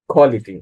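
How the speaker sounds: কোয়ালিটি said "khoality", aspirated at the start. Shown as a demonstration. Teacher models the corrected word, Q as খ. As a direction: puff air on the K of কোয়ালিটি. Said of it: "খ রয়েছে".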